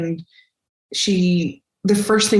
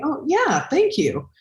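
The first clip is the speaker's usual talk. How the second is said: owning the compliment; 9 semitones higher, slower than usual. Shown as a demonstration. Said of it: "sit in your seat of power and say".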